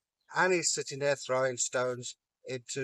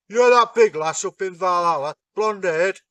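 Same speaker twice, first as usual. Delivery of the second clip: 1 leering giant; 4 semitones higher, 9 dB louder than usual. Acted. Giant asks for Freya.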